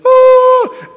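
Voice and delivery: long, high, loud "oh"; adrenaline-rush cry. Retold or imitated. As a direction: let out a hammed-up adrenaline howl. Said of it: "so much adrenaline pumping through me".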